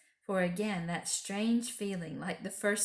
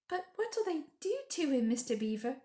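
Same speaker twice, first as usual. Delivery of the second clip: gasping; 8 semitones higher than usual. Acted. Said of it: "gasped Lucy".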